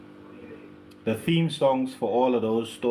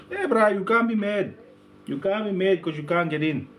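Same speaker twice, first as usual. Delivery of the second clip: higher-pitched mock taunting; playful taunt; 7 semitones higher than usual. Club-goer to someone left outside, playful taunt. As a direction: needle them lightly, pitched up.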